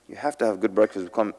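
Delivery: ordinary talk, nothing marked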